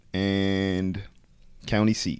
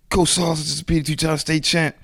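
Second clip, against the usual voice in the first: higher, louder eager news; excited phone call; 9 semitones higher, 6 dB louder than usual. Retold or imitated. Wrestler calling his coach, higher and excited. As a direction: burst out the big news. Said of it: "I call my coach".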